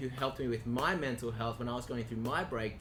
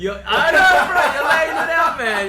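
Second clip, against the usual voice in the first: loud laughing agreement, much higher; real laughter, louder and higher; 12 semitones higher, 17 dB louder than usual. Real, not acted. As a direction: laugh hard while egging her on. Host laughing at the story.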